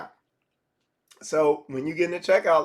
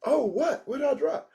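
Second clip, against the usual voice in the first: higher, surprised woman's reply; a puzzled higher question as the woman; 8 semitones higher than usual. Retold or imitated. Woman asking what she dropped, higher and surprised. Woman in pickup line skit.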